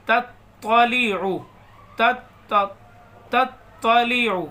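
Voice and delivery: تطلع with the ط doubled and slow; تطلع with a stressed double t; doubled toay. Shown as a demonstration. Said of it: "یہ توئے کو double پڑھتے ہیں".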